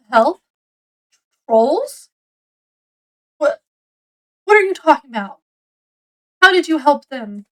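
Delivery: lightly acted incredulous questioning; demanding mom. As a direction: imitate a mother demanding answers, mildly. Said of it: "mom demanding".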